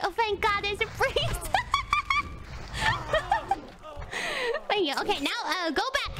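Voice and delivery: ordinary talk, nothing marked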